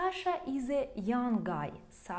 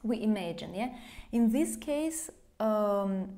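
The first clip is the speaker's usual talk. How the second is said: ordinary talk, nothing marked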